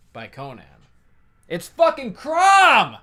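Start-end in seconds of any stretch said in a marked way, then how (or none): 1.5-3.0 big, high, slow shouted reveal; much higher, louder triumphant announcement; excited reveal. Real, not acted. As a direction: shout the name out like a punchline. Revealing who sits on the mountain.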